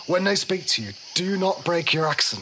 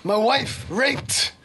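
aggrieved soldier; 9 semitones higher, slower than usual. Acted. Soldier listing losses to silent stranger, building fury. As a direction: declaim it slowly, then erupt. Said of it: "So the soldier".

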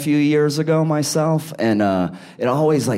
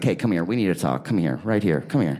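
mock stern uncle; 4 semitones lower than usual. Uncle confronts his niece about weed, low and mock stern. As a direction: pull the kid close and lay into her. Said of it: "So I was like".

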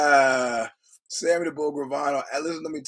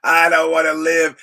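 louder and higher wail; mock wailing scream; 5 semitones higher, 11 dB louder than usual. Host acting out the sobbing scream from the movie, hammed up. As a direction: ham up a despairing cry. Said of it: "You start sobbing and screams".

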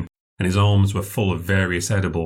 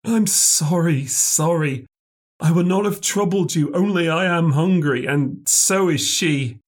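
hopeless and pathetic; 9 semitones higher than usual. A narrator's reading. Hungry castaway apologising to sailors from afar, hopeless. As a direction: plead weakly, drawing out the sorrow. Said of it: "sounding extremely hopeless and pathetic".

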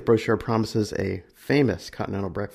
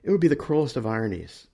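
ordinary delivery

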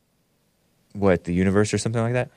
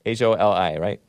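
normal talking voice

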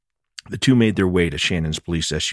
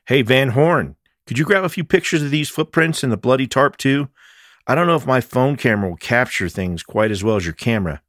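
calling out; 8 semitones higher, 5 dB louder than usual. Acted. Calling across the cave.

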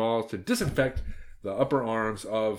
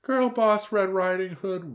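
mock whimper; 10 semitones higher, 4 dB louder, slower than usual. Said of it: "whimpered the aunt".

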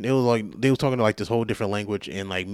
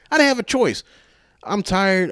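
high-pitched, louder protest; exasperated protest; 10 semitones higher, 5 dB louder than usual. Real, not acted. Defending himself to viewers, exasperated and high. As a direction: plead helplessness, voice jumping up high. Protests his own helplessness.

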